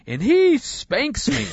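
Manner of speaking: put-on high, slow, loud child scream of a confession. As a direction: shriek it out slowly so the whole plane hears. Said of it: "and she screams".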